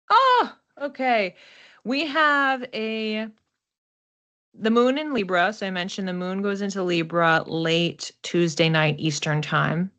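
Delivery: usual speaking voice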